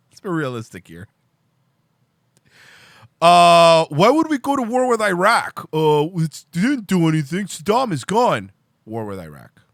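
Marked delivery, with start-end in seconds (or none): 3.2-8.5 high, loud dopey parody; sneering impression of a war cheerleader; mock naive voice. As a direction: ham up the clueless hawk. Mocking voice on the Iraq war.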